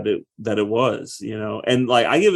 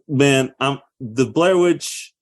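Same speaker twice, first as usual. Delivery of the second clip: ordinary delivery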